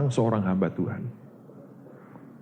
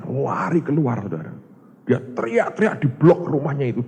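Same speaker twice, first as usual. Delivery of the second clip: ordinary delivery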